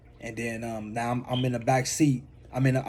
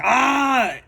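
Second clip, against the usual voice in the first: high retold scream of pain "Ah"; 10 semitones higher than usual. Beaten in the alley, a high retold scream. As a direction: let out a helpless cry. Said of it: "I got the yelling and screaming like".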